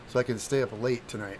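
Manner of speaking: usual speaking voice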